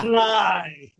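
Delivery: playful drawn-out shout; mock command. Panelist heckling the host's warning, mock shouted command. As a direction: call it out as a joke order, then laugh.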